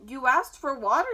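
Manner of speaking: plain reading voice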